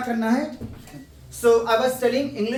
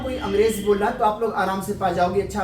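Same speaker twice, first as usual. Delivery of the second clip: normal talking voice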